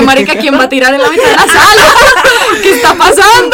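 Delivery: loud, high, laughing disbelief; retold baffled outcry. Retelling furniture arriving mid-party, loud laughing disbelief. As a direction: yell it bewildered, breaking into laughter. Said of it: "Yo dije".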